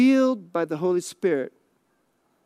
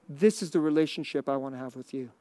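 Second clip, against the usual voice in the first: soft, low, tender call; gentle invitation; 8 semitones lower, 6 dB quieter than usual. Shown as a demonstration. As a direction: invite them in quietly. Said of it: "Jesus dwells you to say".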